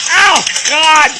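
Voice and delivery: ordinary talk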